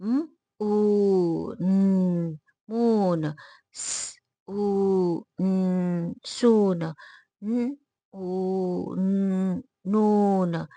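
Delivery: long held ऊ between consonants, then blended 'मून', 'सून', 'नून'; sounding out. Shown as a demonstration. Teacher drilling long-vowel words, sounds drawn out. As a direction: stretch each sound separately, then the whole word. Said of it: "चलिए बोलते हैं".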